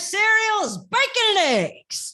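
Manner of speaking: high put-on preacher voice; hammed-up down-home phrase; evangelical catchphrase. Suggesting phrases for his evangelical act, high and put-on. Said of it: "You have phrases".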